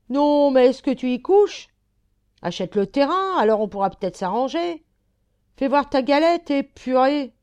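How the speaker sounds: high, loud indignant rant; indignant heckling. Acted. Driver rants at a broken-down car blocking the street, indignant. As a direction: heckle them angrily, voice pushed up high.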